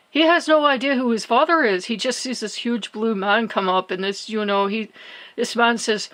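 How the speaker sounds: ordinary delivery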